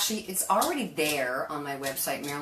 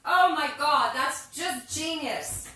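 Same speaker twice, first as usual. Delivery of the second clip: high, slow gushing thanks; overjoyed gushing thanks; 11 semitones higher, 7 dB louder, much slower than usual. Real, not acted. Over-the-top thanks for tip.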